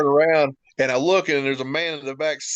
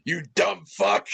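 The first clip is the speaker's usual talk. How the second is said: imitating crude Bull; 5 semitones higher than usual. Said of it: "as eloquent as he could possibly be".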